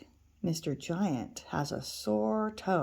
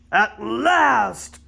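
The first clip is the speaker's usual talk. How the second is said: booming giant; 3 semitones higher, 8 dB louder than usual. Acted. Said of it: "boomed the big voice of the giant".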